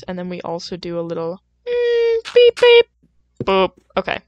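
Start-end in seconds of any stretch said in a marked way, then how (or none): 1.7-3.7 squeaky high beep beep boop; high beeping noises. Retold or imitated. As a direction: chirp the synth notes high. Imitating music sounds.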